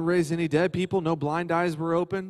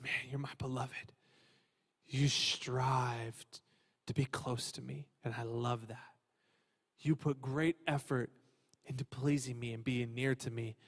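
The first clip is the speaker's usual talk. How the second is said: tender, quiet Father; 5 semitones lower, 14 dB quieter, slower than usual. Acted. The Father speaks to Jesus.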